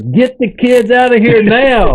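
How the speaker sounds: high, slow urgent command to his wife; urgent order. Retold or imitated. Man telling his wife to take the kids, urgent high command. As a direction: bark it out, hurry the family off.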